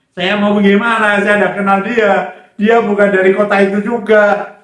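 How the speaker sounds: high, loud objection; retold exasperated outcry; loud protest. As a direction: protest at full voice, pitched well up. Protesting to the Holy Spirit's prompting.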